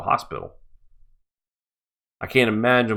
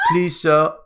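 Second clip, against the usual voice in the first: higher-pitched mock Australian plea; put-on Oliver Twist Australian, pitched up; snowflake Australian accent; 6 semitones higher than usual. Shown as a demonstration. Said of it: "what the snowflake Australian sounds like".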